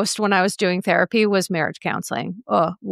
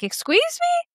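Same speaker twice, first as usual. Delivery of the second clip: indignant shock. Retold or imitated. Indignant quote to nutritionist.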